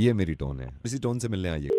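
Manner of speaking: very deep, hushed voice; mock-loving tone. Prankster invites the woman to meet in this tone, mock-tender. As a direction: purr it low and soft. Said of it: "बहुत प्यार से कहे".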